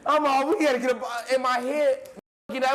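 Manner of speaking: normal talking voice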